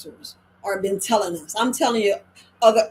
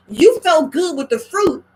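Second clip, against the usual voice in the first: emphatic scolding; 6 semitones higher, 7 dB louder than usual. Real, not acted. Scolding a friend who relapsed.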